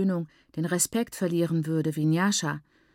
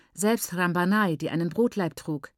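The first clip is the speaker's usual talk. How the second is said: plain reading voice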